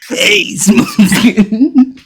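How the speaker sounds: mock Illidan, breaking into laughter; Illidan quote. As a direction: stretch it out while cracking up. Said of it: "my favorite Illidan quote".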